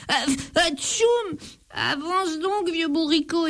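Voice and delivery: sneeze, then beg again; sneezing plea. A narrator's reading. The thief under the straw sneezes, then begs the donkey. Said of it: "éternue le voleur".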